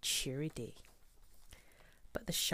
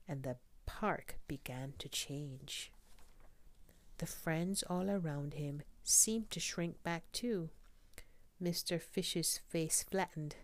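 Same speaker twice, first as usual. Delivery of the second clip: ordinary delivery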